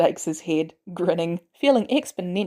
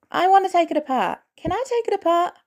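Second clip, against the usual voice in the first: put-on higher boyish voice, wide-eyed wonder; a teen boy gushing over the coolest thing ever; awestruck kid; 11 semitones higher than usual. A narrator's reading. Peter gushing to Barnes about his metal arm, awestruck.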